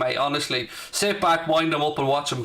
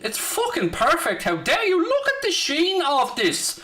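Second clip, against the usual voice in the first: mock outrage; 9 semitones higher than usual. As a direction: play wounded vanity over his shiny head, squeaky and grand. Mock offence at bald joke.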